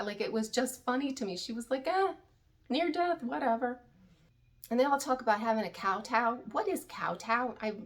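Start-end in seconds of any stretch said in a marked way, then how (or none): 1.9-3.8 mock unmoved shrug, pitched up; Janelle. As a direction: imitate someone who couldn't care less. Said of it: "she couldn't have even cared".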